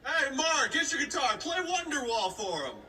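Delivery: high, loud, drawn-out coaxing; pushy parent. Retold or imitated. Parent urging son to play guitar, high and pushy. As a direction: imitate a parent calling on the kid.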